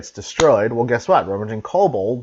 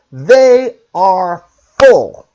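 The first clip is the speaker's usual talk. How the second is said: "full" drawn out slow and loud; stress on full; 7 semitones higher, 11 dB louder, much slower than usual. Real, not acted. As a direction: hammer "They are full" word by word. Repeats slower and louder.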